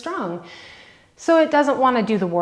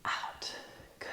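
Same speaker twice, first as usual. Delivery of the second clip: whispering; 21 dB quieter than usual. Real, not acted. Counting reps in class.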